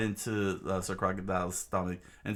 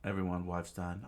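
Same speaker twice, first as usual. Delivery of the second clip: plain reading voice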